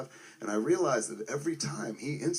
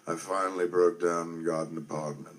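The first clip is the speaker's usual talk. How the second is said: a hint of his deep measured voice, lower; Alan Rickman impression; 6 semitones lower than usual. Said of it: "I can't do his accent the whole time".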